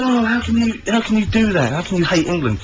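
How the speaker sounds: disbelieving fans, mock outrage; incredulous people. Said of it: "people said".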